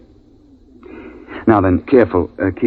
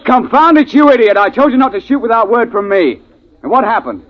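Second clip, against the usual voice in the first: furious scolding; 13 semitones higher than usual. Acted. Guide berating the trigger-happy hunter, furious. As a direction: tear into him for disobeying.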